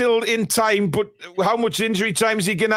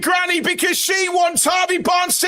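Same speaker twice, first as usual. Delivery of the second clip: much higher, shouted goal call, slowing on the last words; ecstatic goal shout; 8 semitones higher than usual. Real, not acted. Fan commentator yelling the late goal to viewers, ecstatic shout. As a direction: roar it like the winner just went in.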